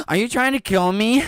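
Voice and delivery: very high, loud mock hysteria; melodramatic customer. Imitating the customer demanding water, hammed-up high panic. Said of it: "she's like".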